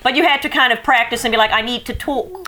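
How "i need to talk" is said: softly mimicked upper-crust British; upper-crust British accent. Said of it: "kind of upper crust it".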